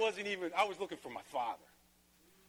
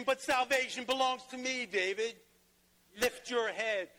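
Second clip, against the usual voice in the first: raised, higher preaching voice building to a climax; preaching at full force; 8 semitones higher, 5 dB louder than usual. Acted. Pastor rousing the congregation about God's promise, full-force preaching. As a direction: lift the room, preach it out loud and high.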